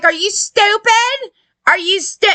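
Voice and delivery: are-you-kidding-me disbelief; incredulous. Retold or imitated. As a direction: ask it in exasperated disbelief, pitched right up. Exasperated retort to the media.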